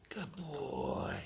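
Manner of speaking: hushed pet-talk praise; tender praise. Real, not acted. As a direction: praise the dog softly, drawing it out. Soft praise after dog obeys.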